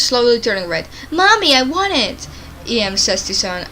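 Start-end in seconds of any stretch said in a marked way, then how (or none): 1.1-2.3 put-on high, slow, whining child; whiny toddler voice. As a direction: beg like a spoiled little kid.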